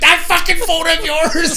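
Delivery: high, loud, slow, laughing through it; exasperated laugh at the noisy phone; wife laughing at the ringing phone; laughing complaint. Retold or imitated. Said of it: "And Greta's like".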